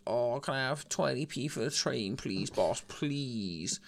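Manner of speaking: wheedling tramp begging for change. Acted. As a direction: put on a pleading street beggar. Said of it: "puts on his best tramp voice".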